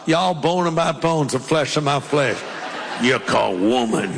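put-on Southern accent. Preacher imagining Adam from the South, Southern accent. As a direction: say it like a man from the American South. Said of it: "like he was from the South".

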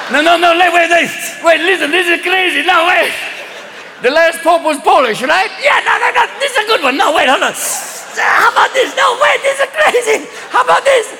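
high-pitched stoned excitement; loaded cardinals. Acted. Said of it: "getting a little loaded".

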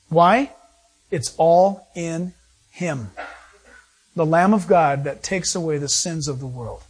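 ordinary talk, nothing marked

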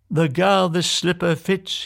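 high, declaiming prince; proclaiming cry. A narrator's reading. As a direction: announce it like a royal vow. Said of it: "he cried".

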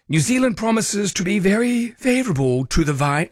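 put-on half-hearted stab at an old English accent; mock missionary accent. Host quoting the missionary's journal, light old English accent. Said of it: "Actually, I won't do the accent".

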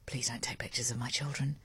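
hushed, unvoiced plea; retold whisper. Mother quietly asking a stranger not to photograph, whispered. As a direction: whisper it firmly but discreetly.

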